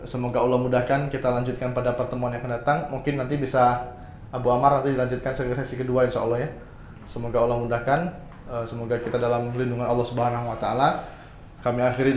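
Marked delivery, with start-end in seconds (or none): none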